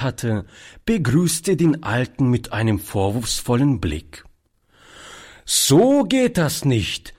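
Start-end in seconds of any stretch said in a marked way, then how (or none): 5.4-7.1 put-on high, slow scolding from a know-it-all boy; drawn-out precocious reproach. A narrator's reading. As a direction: lecture like a child playing the grown-up. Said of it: "sagte er altklug".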